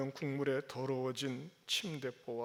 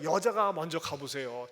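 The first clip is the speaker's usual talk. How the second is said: normal talking voice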